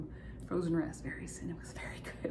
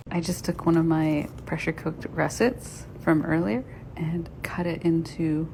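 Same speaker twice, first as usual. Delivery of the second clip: ordinary delivery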